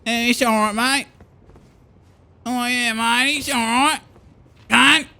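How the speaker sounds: high mock Australian drawl, easygoing; lightly mocking Australian bloke shrugging it off; Australian accent. Australians forgiving the rapper, in a high mock Australian accent. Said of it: "they would have been like".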